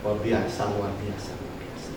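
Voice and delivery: fading from soft to a breathless whisper; awed fade to whisper. Real, not acted. Preacher marveling at God's care, fading to a whisper. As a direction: let the words die away in wonder.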